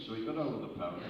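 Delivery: ordinary delivery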